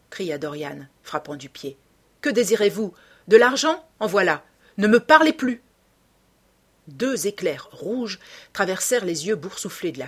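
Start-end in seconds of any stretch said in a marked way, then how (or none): 2.2-5.6 loud, high, impatient outburst; angry, raised-voice dismissal; exasperated anger. A narrator's reading. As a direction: fire off questions, then shout her away. Dorian angrily pays off the woman.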